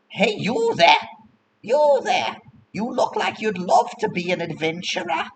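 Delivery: high-pitched pushy vendor; street hawker. Acted. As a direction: hail a passer-by in a high sales voice. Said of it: "you'll hear somebody saying".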